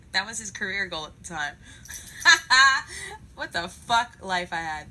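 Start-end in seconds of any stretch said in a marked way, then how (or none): 1.6-3.3 loud, high burst of laughter. Real, not acted. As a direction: laugh out loud at your own story. Laughing at her own story.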